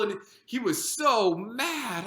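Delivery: ordinary delivery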